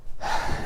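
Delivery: heavy exasperated exhale; frustrated sigh. Real, not acted. Vlogger comparing the candidates' bills, frustrated sigh. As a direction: breathe out in frustration. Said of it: "it's frustrating".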